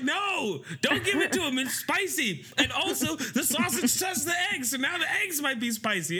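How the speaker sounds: high panicked imitation; high frantic wife quote. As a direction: stop him fast. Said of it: "Kyle's like".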